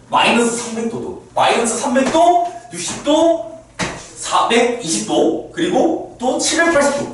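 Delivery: teacher suddenly getting carried away; sudden excitement. Real, not acted. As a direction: blurt it out all fired up. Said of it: "또 급발진해".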